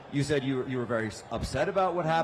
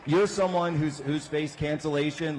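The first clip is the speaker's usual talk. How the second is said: ordinary delivery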